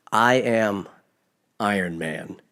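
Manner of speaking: low and hushed, with a pause; solemn last words. Acted. Tony answers Thanos before snapping, solemn and hushed.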